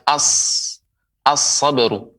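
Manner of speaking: "as-sabru" with a long held s; lam merged into sad. Shown as a demonstration. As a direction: break off on "as-", then "as-sabru" with doubled s.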